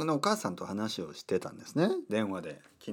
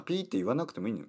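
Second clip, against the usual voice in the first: usual speaking voice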